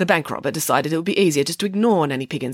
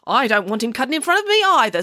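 lightly voiced, higher-pitched old lady; 7 semitones higher, 3 dB louder than usual. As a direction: complain like an old lady in a queue. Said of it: "said an old lady".

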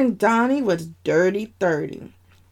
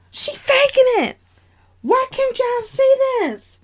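much higher, shrill-free exasperated accusing voice; indignant sister. Acted. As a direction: protest that nobody sees the fake. Sister insists she's faking.

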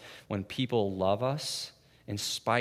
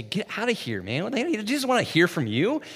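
annoyed crowd; 9 semitones higher, 4 dB louder than usual. Retold or imitated. Crowd shushing the blind beggar.

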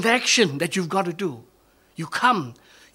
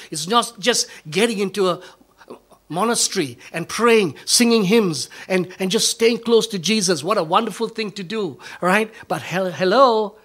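louder, building fervour; preaching at full force; 4 semitones higher, 7 dB louder than usual. Acted. Pastor stirring the congregation beyond the monastery walls, full-force preaching. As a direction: swell up and call them out.

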